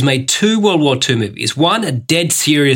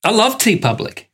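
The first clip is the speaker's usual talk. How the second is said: higher-pitched, put-on keenness; forced enthusiasm; 7 semitones higher than usual. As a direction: overdo a scripted sponsor plug. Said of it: "was that too, too forced?".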